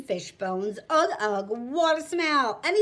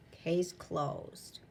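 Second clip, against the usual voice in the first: soft echo; 8 semitones lower, 10 dB quieter than usual. Acted. Echo of the ruling.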